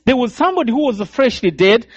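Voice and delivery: ordinary delivery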